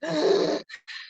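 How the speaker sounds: sharp mock gasp for air; imitated gasp. Pastor imitating a dying father fighting for breath, gasping. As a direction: gasp like lungs that won't fill. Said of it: "and he's like".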